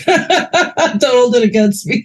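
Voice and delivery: teasing laugh, slow and high. Real, not acted. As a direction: chuckle through a self-deprecating joke. Joking about his New York accent.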